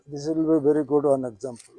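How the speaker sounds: ordinary talk, nothing marked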